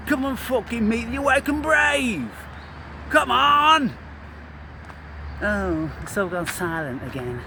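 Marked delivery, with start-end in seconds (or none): 0.1-3.9 taunt. Real, not acted. Taunting viewers to meet him.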